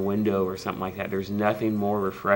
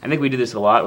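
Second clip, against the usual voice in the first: ordinary delivery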